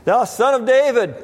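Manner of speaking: imitated cry for help, pitched well up. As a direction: call out for mercy, drawing out the last words. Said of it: "began to cry out and say".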